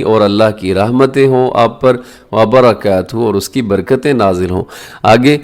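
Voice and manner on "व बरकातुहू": normal talking voice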